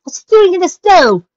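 high, loud worried question; alarmed. Acted. Daughter alarmed for father.

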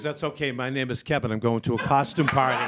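suddenly low male reveal voice; deep, unbothered confession; man disguised as nun. Acted. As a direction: drop low for the punchline reveal. Nun reveals she is Kevin.

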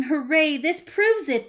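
high, loud cheering; excited shouting. A narrator's reading. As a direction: burst out in storybook celebration. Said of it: "so excited, shouting".

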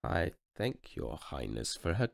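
soft, slow deflated thanks; quiet drawn-out graciousness; crushed painter. Acted. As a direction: thank her through gritted pride. Painter answers humiliated.

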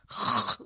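huge sucked-in snoring breath; exaggerated snore imitation; imitated chainsaw snore. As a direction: suck up all the air in a mock snore. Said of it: "he was sucking up all the breath".

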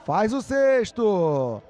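stretched, loud goal cry; goal shout. Real, not acted. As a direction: belt out the goal and scorer. Goal called for Brazil.

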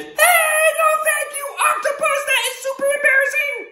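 very high, loud, fast protest; embarrassed boy. Acted. Boy protesting his underpants on the statue, high squeal. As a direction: squeal it far below the statue. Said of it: "and he says".

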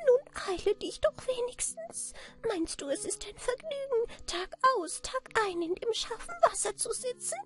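very high, squeaky, impatient urging; impatient little man. A narrator's reading. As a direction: hurry her along in a tiny piping voice. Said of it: "sagte es ungeduldig".

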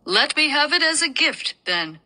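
lightly voiced young prince. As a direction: plead as a gentle prince.

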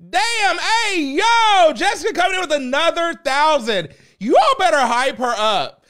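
high, loud screaming hype; excited hype yell. Real, not acted. Streamer screams at chat over Jessica's donation. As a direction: shout it like a big win.

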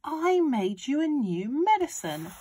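put-on high, insistent boy voice; shouting back. A narrator's reading. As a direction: insist it loudly, stretching the words. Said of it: "George shouted back to her".

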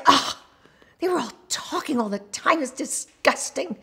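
high, exasperated backstage whine; hammed-up outraged complaint; mock disgust. Retold backstage complaint about bad audiences.

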